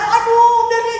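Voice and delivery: high, loud mock panic; panicked mother. Wife telling of her sick child, acted panic. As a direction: re-enact a mother starting to panic. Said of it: "gitu, udah panik".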